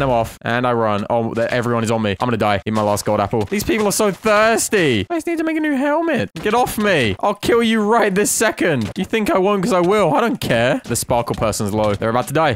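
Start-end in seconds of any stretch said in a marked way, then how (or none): 3.4-10.8 high, put-on squeaky taunting voice; mock threat. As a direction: taunt the players in a silly high voice. Taunting players attacking him in-game.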